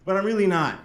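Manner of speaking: usual speaking voice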